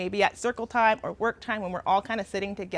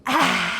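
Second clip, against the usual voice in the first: tongue-out breathy ah, a little louder; lion's breath; 2 semitones higher, 5 dB louder than usual. Shown as a demonstration. Teacher demonstrating lion's breath for the class, tongue-out ah. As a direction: exhale hard with your tongue out. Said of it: "Big through your nose".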